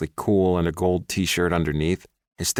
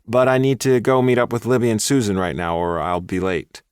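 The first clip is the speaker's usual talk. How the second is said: firm; 5 semitones higher, 5 dB louder than usual. A narrator's reading. Pushes ex away.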